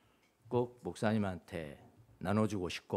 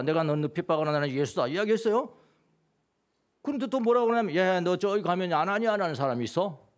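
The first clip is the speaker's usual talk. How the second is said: louder, higher preaching voice with rising challenges; fervent preaching; 8 semitones higher, 5 dB louder than usual. Acted. Pastor pressing the congregation about Paul, fervent preaching. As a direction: drive it home to the congregation.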